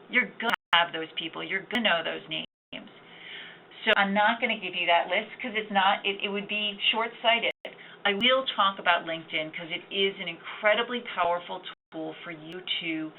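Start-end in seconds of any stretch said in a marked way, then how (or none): none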